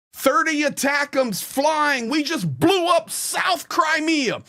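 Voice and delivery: mocking hype. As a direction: lampoon the online cheerleaders. Mocking hopium war posts.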